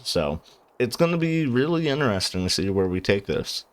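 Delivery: ordinary delivery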